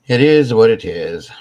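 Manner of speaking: loud, emphatic repeat; loud declaration. Real, not acted. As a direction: belt it out, resigned. Repeats it, much louder.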